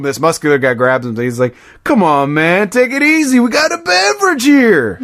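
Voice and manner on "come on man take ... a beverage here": high, climbing mock protest; protesting imitation. The Dude grabbed by a muscular guy, high mock protest. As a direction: imitate a whining complaint, rising and stretched at the end.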